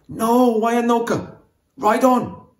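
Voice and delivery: high, loud desperate command; wounded man shouting her away; urgent shout. A narrator's reading. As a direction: urge her to flee while falling. Wounded warrior urges her on.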